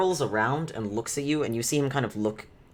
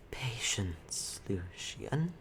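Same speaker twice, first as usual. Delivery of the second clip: whispered; 8 dB quieter, much slower than usual. Acted. Webmother calming Lucian, whispered. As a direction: hush him with a whisper. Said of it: "the Webmother says".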